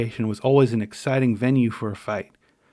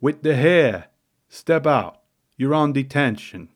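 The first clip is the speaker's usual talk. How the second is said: loud gruff Italian-American New York proctor, heavy stress on the second syllable of detention; hammed-up tough-guy lunch monitor ordering a kid out; gruff Mister Delia; 2 semitones higher, 4 dB louder, slower than usual. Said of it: "I'm probably not doing this accent justice".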